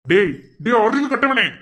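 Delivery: furious telling-off; angry scolding. Acted. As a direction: lash out at someone foolish. Scolding in acted family emergency.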